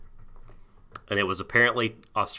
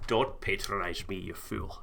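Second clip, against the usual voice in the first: lower, much softer haughty Viking; a lightly voiced offended Viking; mock Viking accent; 3 semitones lower, 12 dB quieter than usual. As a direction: sneer it quietly in a put-on Norse brogue. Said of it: "Historically accurate Norwegian Vikings".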